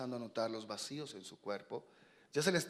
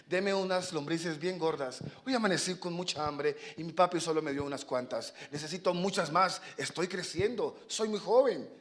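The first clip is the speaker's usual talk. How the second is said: put-on higher, childlike begging; pleading young swallow; 4 semitones higher, 3 dB louder than usual. Young swallow begging the fox for worms, eager. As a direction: plead for more, climbing as you go.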